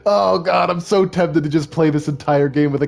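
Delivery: loud, high, giggly outburst; laughing, tempted. Real, not acted. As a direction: cry it out through helpless laughter. Giggling over silly camera-head costume.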